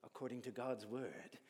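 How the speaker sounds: put-on an Irish accent for an old King James verse. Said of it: "not in that accent".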